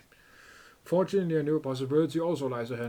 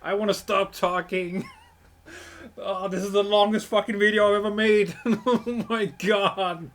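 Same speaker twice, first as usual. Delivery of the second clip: tired laughing; 7 semitones higher, 4 dB louder, slower than usual. Real, not acted. Exhausted laughing complaint.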